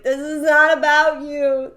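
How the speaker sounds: retold indignant teen. Teen daughter telling off dad, loud slow indignant retort. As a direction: insist it slowly, mock-exasperated. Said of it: "and I was just like".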